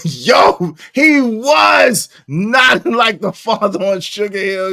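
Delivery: loud, slow, laughing through the words. Real, not acted. Streamer cracking up to chat, loud laughing slowly. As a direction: crack up while you get it out.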